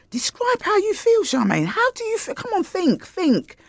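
very high-pitched inner pleading; urgent self-coaxing. Acted. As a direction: act out pushing your own brain to answer. Acted inner voice urging herself on.

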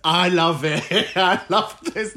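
delighted, laughing through the words. Real, not acted. Host relishing a caller's shade, laughing.

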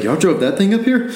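high, loud amazed question with a laugh; can't believe they drove it up; disbelieving. Retold or imitated. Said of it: "I was like".

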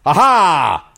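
loud, high "aha!"; gleeful gotcha. Real, not acted. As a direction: crow in triumph at catching them out. Triumph at catching the mispronounced word.

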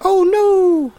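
very high-pitched cartoonish wail of dismay; high comic impression, mock alarm; Mister Bill. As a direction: squeal it like a helpless little clay figure. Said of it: "Oh, Mister Bill?".

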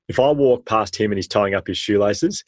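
usual speaking voice

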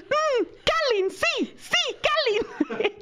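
very high, eager confirming; imitating Spanish-speaking mother. Perla's mother takes Kathleen as his name, imitated high and eager. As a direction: agree eagerly in a squeaky mimic voice. Retold delighted mix-up over a name.

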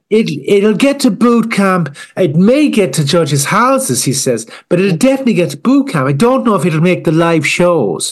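high, gushing judge voice; mock Louis Walsh. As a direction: gush at the wallpaper like a star judge. Louis Walsh judging the car.

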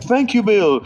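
high, gushing thanks in a lightly voiced Mario; relieved friend thanking Bill, high and eager; grateful friend. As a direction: pitch it up like a shaken man thanking his rescuer.

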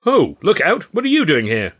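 high, loud startled shout; startled cry. A narrator's reading. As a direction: jump back in alarm and cry out. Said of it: "he cried".

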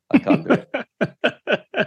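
breaking into laughter; giggling through the words; laughing. Real, not acted. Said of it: "I'm not gonna do one".

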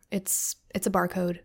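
ordinary talk, nothing marked

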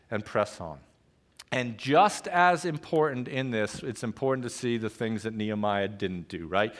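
usual speaking voice

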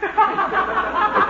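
a laugh breaking into the words; chuckling. Real, not acted. Announcer amused by his own joke, chuckling. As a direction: laugh through it.

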